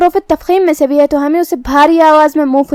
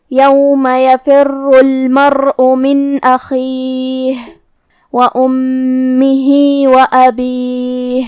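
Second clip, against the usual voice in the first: slow, drawn-out tajweed chant; measured melodic Quranic recitation; much slower than usual. Shown as a demonstration. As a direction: recite it slowly with full elongation.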